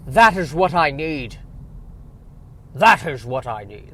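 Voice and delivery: bad Scottish Connery voice; Sean Connery impression. Vlogger doing a horrible Sean Connery impression, bad Scottish accent. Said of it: "my horrible Sean Connery impression".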